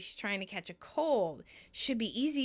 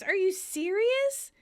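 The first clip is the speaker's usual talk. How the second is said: high, slow incredulous question; acted disbelief; 10 semitones higher, 4 dB louder, slower than usual. Ross asks Chandler about his confession, high and incredulous. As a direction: stretch it out in mock shock.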